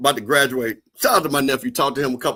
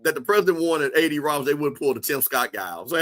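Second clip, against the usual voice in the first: ordinary talk, nothing marked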